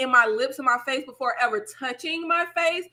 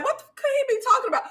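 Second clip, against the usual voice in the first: confused inner voice; 11 semitones higher, much faster than usual. Retold or imitated.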